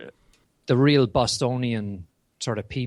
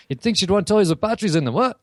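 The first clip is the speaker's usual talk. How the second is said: higher, louder mock remark; woman's retort; 8 semitones higher, 5 dB louder than usual. Woman joking about her friend, raised mock voice. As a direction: lightly voice a woman's cheeky aside. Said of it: "And she just goes".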